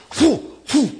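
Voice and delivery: loud, high punch noises; playful mock punch sounds; mock punches. Pastor imitating brother's punches.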